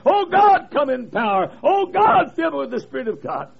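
crying out Oh God high and loud; a preacher's anguished prayer by the lake; desperate praying preacher. Retold or imitated. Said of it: "And he said".

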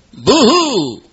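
hammed-up boo-hoo, high and loud; imitating a lady's sobbing for laughs; mock sobbing. Preacher mocks a crying lady, hammed-up boo-hoo.

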